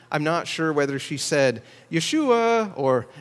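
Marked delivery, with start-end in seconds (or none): 1.9-2.7 put-on calling the boy in for dinner, high and loud; mother calling out. Mother calls her boy in for dinner, loud and high. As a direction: sing it out like a mother calling from the door. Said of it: "Mary was calling the boy Jesus in for dinner".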